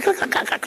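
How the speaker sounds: high, airy whispered crac crac imitating timbers; creaking wood sound. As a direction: mimic the house creaking, breathy and high. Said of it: "la madera suena crac".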